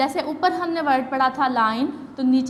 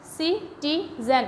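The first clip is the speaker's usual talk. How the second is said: Citizen stretched slowly, each short vowel clear; slow, syllable by syllable; 3 semitones higher, 3 dB quieter, much slower than usual. Shown as a demonstration. Teacher showing pupils the short vowels, slowly. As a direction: draw out citizen one syllable at a time. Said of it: "तीनों की तीनों sound short vowel".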